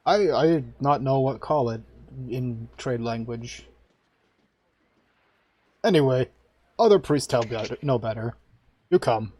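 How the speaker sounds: lightly voiced priest in broken trade language; halting foreign priest. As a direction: speak as a curt, halting priest.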